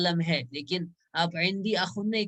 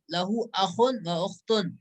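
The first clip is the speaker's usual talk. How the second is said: slow reading aloud; slower than usual. Shown as a demonstration. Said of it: "میرا جو ہم جماعت ہے".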